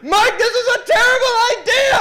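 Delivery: very high, loud imitation of a panicking friend; shrill cry. Friend protesting while burning in the water, shrill yell. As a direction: scream the complaint in mock agony. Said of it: "hearing our shrill cries from above".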